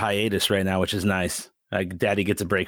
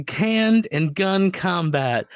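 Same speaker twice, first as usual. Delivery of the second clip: imitating kid's reading; 8 semitones higher, much slower than usual. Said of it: "reading Rainbow quality".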